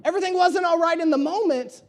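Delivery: normal talking voice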